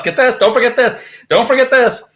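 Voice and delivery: sarcastic don't-forget-your-stuff voice; mock-helpful taunting. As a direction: toss each line like a cheery goodbye you don't mean. Mockingly throwing her stuff out.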